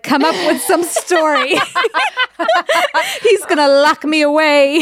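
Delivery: a Gone with the Wind damsel pleading, half laughing; put-on Southern belle accent. Driver spins a sob story to the cop in a mock Southern belle accent. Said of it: "You just start putting on an accent randomly".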